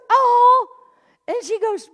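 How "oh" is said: flattered oh. Retold or imitated. Cashier flattered.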